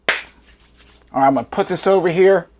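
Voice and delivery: usual speaking voice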